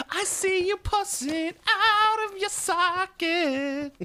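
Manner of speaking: high, slow creepy squeak; squeaky monster voice. Pretend. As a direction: drag it out like a gross little creature. Voicing the herpes eye figure.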